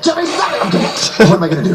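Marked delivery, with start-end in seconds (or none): 0.0-0.6 high-pitched frustrated outburst; exasperated scolding. Acted. Father telling his son to quit, exasperated. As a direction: order him to quit, losing patience.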